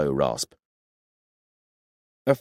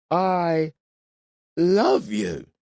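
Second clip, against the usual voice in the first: put-on drunk; 4 dB louder, much slower than usual. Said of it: "You're drunk".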